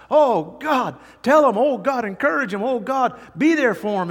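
high, loud pleading cry to God; anguished prayer. Acted. Pastor praying aloud for a hurting brother, pleading high and loud.

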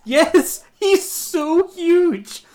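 very loud, high, stretched-out exclamation; real excitement. Real, not acted. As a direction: shout it, dragging out the amazed words. Thrilled confirming the actor's size.

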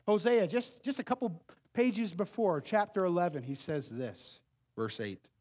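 usual speaking voice

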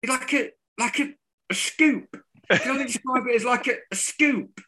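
high, quick mock pundit voice; lightly voiced commentator groping for a word; imitating Gage. Mocking the pundit's "scoop", high and fast. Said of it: "as Gage described it twenty-seven times".

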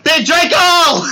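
loud, slow drawn-out yell. Real, not acted. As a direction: belt it out. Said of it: "why are you yelling in your mic".

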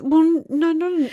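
high, loud flustered denial; loud protest. Real, not acted. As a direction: rush to deny it, voice up.

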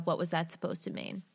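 ordinary delivery

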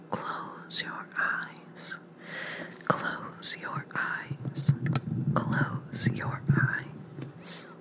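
very slow hypnotic whisper; mesmerizing. Acted. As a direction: lull them under with each repeat. Hypnotic repeated sleep suggestion.

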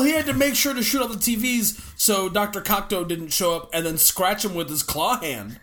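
ordinary talk

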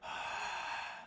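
long unvoiced sigh out; a big relaxed exhale; demo sigh. Shown as a demonstration. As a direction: breathe out slow and audibly to relax them. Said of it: "have a big, relaxed breathe out".

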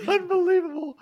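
high squeal through laughter; helpless laughter in the word; laughing hard. Real, not acted. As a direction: say it while cracking up, pitch way up. Host cracking up at the clip.

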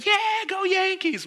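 very high, loud mock fan cheer; shrieking imitation of a rowdy crowd; screamed cheer. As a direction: imitate fans hollering out of windows. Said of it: "they're screaming with him".

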